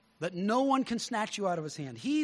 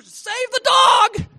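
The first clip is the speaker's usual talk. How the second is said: yelling at the computer; 15 dB louder than usual. Retold or imitated. Viewer yelling at a video of a dog in ice, a loud pleading shout. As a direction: belt it out as if begging the screen to save the dog. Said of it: "yelling at the computer".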